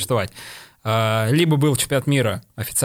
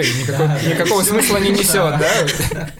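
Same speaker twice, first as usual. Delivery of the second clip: laughing, louder and higher; 6 semitones higher, 4 dB louder than usual. Real, not acted. Laughing at the absurd idea.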